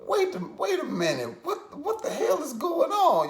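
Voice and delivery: high-pitched, stammering confusion; bewildered disbelief. Retold or imitated. Watching a stranger greet his friend, bewildered disbelief. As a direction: act out baffled disbelief.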